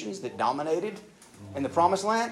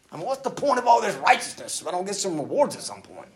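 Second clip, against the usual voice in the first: frustrated complaint; 6 semitones higher, 5 dB louder than usual. Retold or imitated. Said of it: "we don't say that one out loud".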